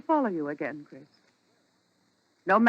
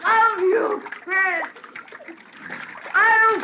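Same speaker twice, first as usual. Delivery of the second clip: haunting vow; 7 semitones higher, slower than usual. Acted. Drowning wife's last threat.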